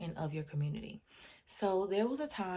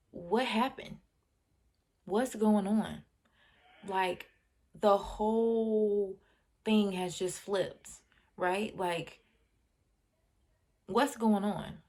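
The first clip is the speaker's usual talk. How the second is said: mock astonishment; 2 semitones higher, 5 dB louder, slower than usual. Acts out astonished parents' questions.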